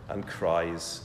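ordinary talk

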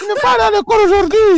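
hammed-up Ivorian mom calling school; accent ivoirien exagéré, voix aiguë; mock Ivorian accent mother. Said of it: "Ça, c'est un accent ivoirien".

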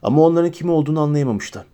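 ordinary delivery, nothing marked

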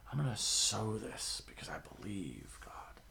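slow, soft whisper; hushed resolve. Retold or imitated. Giver whispering a private promise to sow. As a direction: murmur it like a private vow. Voicing the heart of giving.

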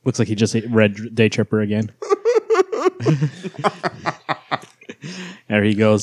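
laughing. Real, not acted. Laughing at friend's red face.

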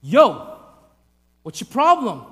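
loud mock challenge; punchy playground bravado; acting tough. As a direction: square up to a bigger kid. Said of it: "gain respect".

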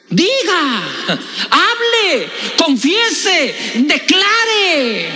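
high-pitched preaching cries, word by word; rousing exhortation. Real, not acted. Preacher urging the church to declare it, high, impassioned cries. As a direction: fire off each command like a proclamation.

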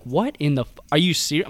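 ordinary talk, nothing marked

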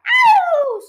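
very high, loud squawked yelp; sudden piercing cry of pain; hen's squeak. A narrator's reading. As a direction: squeal like a startled hen. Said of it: "squeaked the hen".

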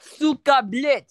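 high, loud screamed Russian catchphrase; mock Russian accent. Shown as a demonstration. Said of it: "that's my Russian thing".

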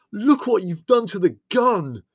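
high, loud, drawn-out yell; outraged, slow high shout; furious yell. A narrator's reading. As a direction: yell in fury over a ruined treasure. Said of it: "yelled Leslie furiously".

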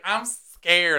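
high-pitched imitation of a frightened kid; hammed-up frightened whine; mock scared. Retells frightened niece running out.

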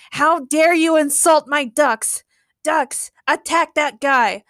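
drawn-out, hammy indignation; indignant command. A narrator's reading. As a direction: order the attack like an offended villain. Offended villain commands his duck army.

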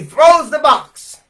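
loud and drawn out; thrown in a panic. A narrator's reading. Preacher telling the boy's panic, loud and slow. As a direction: hurl the words like the box.

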